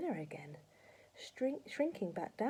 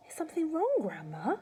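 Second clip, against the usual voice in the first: put-on higher-pitched boyish polite question; 10 semitones higher, 4 dB louder than usual. A narrator's reading. George checking on Grandma, asking politely. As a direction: ask it politely in a lifted voice. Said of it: "George asked her politely".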